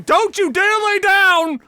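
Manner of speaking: high, slow, loud mock yell; wife yelling. Wife yelling at her downed husband, high slow shout. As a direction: scream it like a ringside wife. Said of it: "the wife is still going".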